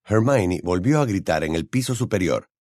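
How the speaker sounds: ordinary delivery, nothing marked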